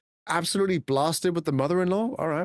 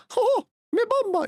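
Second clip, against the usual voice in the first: falsetto cartoon mouse, Jamaican accent; very high squeaky mouse in mock patois; Jamaican Mickey Mouse. Streamer recalling an old redeem, Jamaican mouse voice. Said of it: "we had Jamaican Mickey Mouse".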